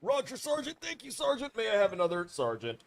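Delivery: high, eager recruit voice; soldier voice. Pretend. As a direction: play a keen private to the sergeant. Soldier answering sergeant.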